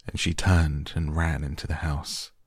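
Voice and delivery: plain reading voice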